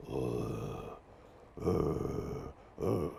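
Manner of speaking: deep, quiet demon breaths; Clive impression breathing. Shown as a demonstration. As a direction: breathe low like Clive creeping. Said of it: "his best Clive impression".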